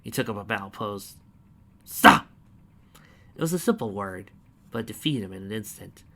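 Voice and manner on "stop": deep booming command; commanding shout. Acted. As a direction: bark it as a goddess. Goddess commands one word.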